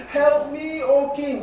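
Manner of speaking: high, loud desperate plea; a woman begging the king, high and loud; woman crying out. Acted. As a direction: cry out for help to the king. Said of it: "a woman cried out to him".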